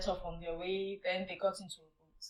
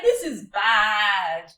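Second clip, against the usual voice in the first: mock shock; 13 dB louder, much slower than usual. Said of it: "You're like".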